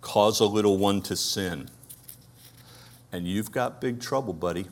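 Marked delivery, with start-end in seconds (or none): none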